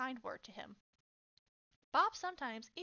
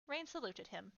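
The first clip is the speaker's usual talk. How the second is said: usual speaking voice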